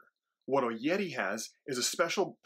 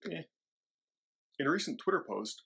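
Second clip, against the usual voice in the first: usual speaking voice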